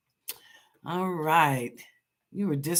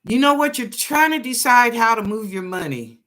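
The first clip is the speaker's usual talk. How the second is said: ordinary delivery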